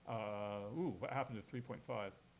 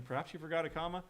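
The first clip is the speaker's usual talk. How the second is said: higher, rising friendly question; kindly American reading; 4 semitones higher, faster than usual. Shown as a demonstration. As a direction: ask it kindly, pitch lifting to the end. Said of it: "as being from an American".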